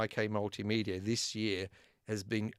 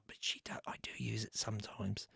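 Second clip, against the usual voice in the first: a secret admitted in a whisper; whispered aside; 9 dB quieter than usual. Real, not acted. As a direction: whisper it under your breath, like a confession. Confessing aside to viewers.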